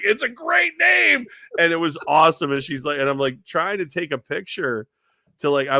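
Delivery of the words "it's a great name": much higher, slow put-on voice; mock the amused husband; imitating husband. Husband's reply voiced by the host, much higher and slow. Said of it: "her husband's like".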